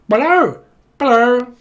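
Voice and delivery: loud, high squawked hello; comic piercing greeting; mock phone hello. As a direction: squawk hello down a bad line. Caller on bad line.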